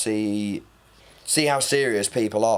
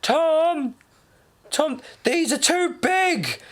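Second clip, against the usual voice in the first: mum calling out; much slower than usual. Retold or imitated. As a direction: call across the shop like an embarrassing mum. Mum at the till.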